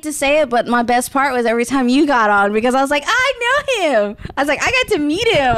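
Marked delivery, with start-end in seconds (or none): 3.1-4.2 high excited squeal. Retold or imitated. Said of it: "I was so excited". 4.6-5.6 excited. Retold or imitated. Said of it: "I was so excited".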